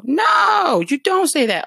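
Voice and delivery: loud high "No," then firm denial; high, loud "No" correcting the listener; emphatic correction. Real, not acted. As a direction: shut down the wrong answer loudly. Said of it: "correcting wrong answer".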